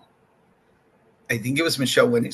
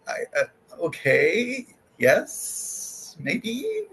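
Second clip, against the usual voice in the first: hesitant answer; 8 semitones higher, 3 dB quieter, much slower than usual. Retold or imitated. Retold unsure answer to odd question.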